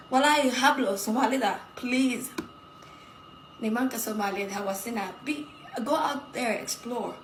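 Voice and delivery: usual speaking voice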